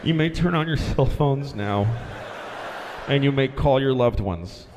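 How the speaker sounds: low, halting cabin announcement; drained captain on the intercom; winded pilot. Acted. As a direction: deliver it spent, pausing for breath. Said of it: "He's totally out of breath".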